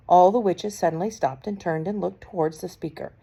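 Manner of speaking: ordinary delivery, nothing marked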